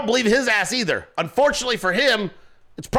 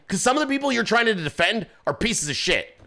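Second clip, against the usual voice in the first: normal talking voice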